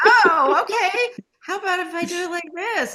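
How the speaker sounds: flustered giggling actress; laughing okay. Retold or imitated. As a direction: laugh it off and try again. Retold reply to director.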